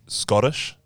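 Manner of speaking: ordinary delivery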